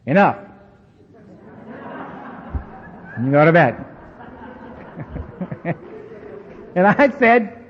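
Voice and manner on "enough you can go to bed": a firm, final enough; said firmly. Retold or imitated. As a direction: close the day with a firm word. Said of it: "I want you to say".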